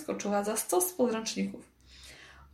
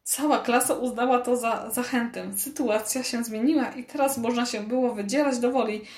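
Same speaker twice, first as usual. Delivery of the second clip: usual speaking voice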